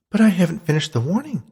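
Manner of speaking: high, insistent protest; ghostly Beatrice. Acted. Ghost insists on finishing her warning, high and urgent.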